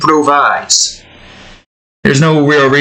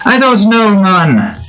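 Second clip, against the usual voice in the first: higher, projected Jamaican voice; Jamaican accent; 6 semitones higher than usual. Shown as a demonstration. Game dev musing aloud in his Jamaican voice. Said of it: "You really gotta, like, project your voice".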